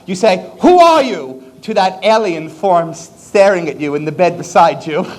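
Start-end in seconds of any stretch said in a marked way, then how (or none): 0.6-1.4 mock outburst. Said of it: "you just say it out loud".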